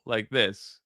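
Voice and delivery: ordinary talk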